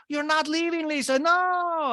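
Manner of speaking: high, drawn-out pleading voice; imitating Alexia. Alexia begging Lisa not to leave, high and drawn out. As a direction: whine it high as she walks out.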